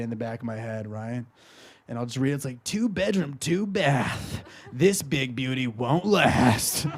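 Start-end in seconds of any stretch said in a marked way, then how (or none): 2.6-6.9 sultry late-night radio patter; sexy radio DJ. Retold or imitated. Radio DJ in his head reads apartment listings, sexy DJ voice. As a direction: read it like a seductive DJ, slowing at the end. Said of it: "some sexy, like, radio D J".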